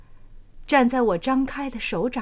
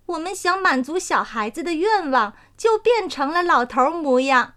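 light, higher, proud little voice; a pleased flower spirit teasing gently; dandelion spirit; 5 semitones higher than usual. Acted. Dandelion reminds the old man of his song, light and higher.

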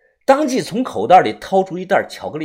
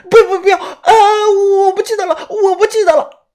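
high, loud panicked denial; terrified crying; 8 dB louder than usual. Acted. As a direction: beg off in terror. Terrified madwoman refusing.

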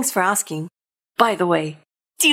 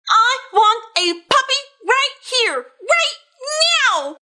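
pleading demand; 10 semitones higher than usual. Acted. Pigeon demands a puppy.

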